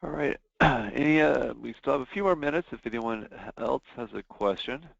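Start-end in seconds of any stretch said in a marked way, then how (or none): none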